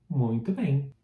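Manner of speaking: ordinary delivery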